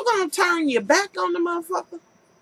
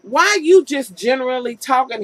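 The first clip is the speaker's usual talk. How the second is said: normal talking voice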